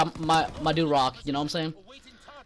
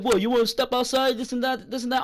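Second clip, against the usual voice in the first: higher-pitched imitation of a challenger, not truly loud; retold loud challenge; 6 semitones higher than usual. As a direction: mimic his challenge in a raised pitch. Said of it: "He's getting loud".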